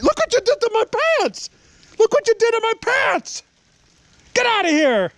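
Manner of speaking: very high, loud yelling; real exasperated high-pitched shouting; furious scolding. Real, not acted. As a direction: shriek at the dog to back off. Yelling at dog over muddy pants.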